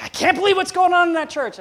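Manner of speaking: high, loud mock outrage at the church; scandalized member griping about church goings-on; complaining churchgoer. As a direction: whine it like a shocked gossip. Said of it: "Sometimes folks say".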